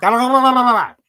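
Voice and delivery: loud, high garbled attempt; frustrated shout. Real, not acted. Learner shouting the Irish word back at the app. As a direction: blurt it out in exasperation.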